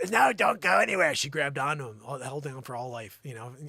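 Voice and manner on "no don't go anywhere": loud, high begging cry; mock desperate plea. Joanne begs milkman not to leave, mock desperate cry. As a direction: cry out as if clinging on.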